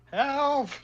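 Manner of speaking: high, loud shout imitating a trapped friend; hammed-up plea for rescue; mock cry for help. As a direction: yell it as if shut in a trunk. Retold cry from inside trunk.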